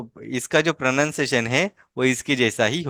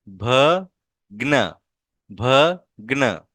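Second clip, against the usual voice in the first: slow reading; much slower than usual. Shown as a demonstration. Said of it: "कैसे पढ़ेंगे?".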